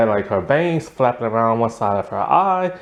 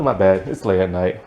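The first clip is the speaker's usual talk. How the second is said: ordinary talk, nothing marked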